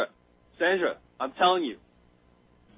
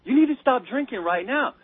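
higher-pitched, urgent imitation of a Canadian character; Randy; 8 semitones higher than usual. Buddy telling a drunk to stop, imitated urgent plea. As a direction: plead with him, mock-earnest and raised in pitch. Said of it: "And he goes".

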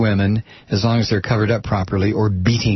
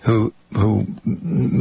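ordinary delivery

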